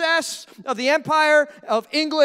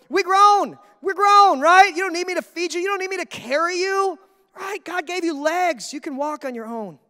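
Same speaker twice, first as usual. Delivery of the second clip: loud, higher-pitched protest; exasperated scolding; 5 semitones higher, 4 dB louder than usual. Acted. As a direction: tell off an overgrown baby, incredulous.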